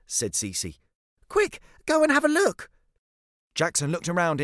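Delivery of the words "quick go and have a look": high, loud urging; urgent girl. Acted. Cece sending Jackson to check the box, urgent. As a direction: hurry them along in a high child's voice.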